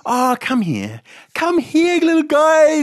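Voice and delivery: high sing-song coaxing of a dog; high baby talk luring a frightened dog. Retold or imitated. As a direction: coax the dog back sweetly. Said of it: "then you'll be like".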